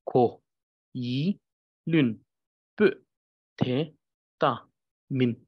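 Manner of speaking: ko, yi, lun spaced out one by one; syllable reading. Shown as a demonstration. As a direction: pause after each syllable. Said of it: "you can actually hear that I'm only focusing on each".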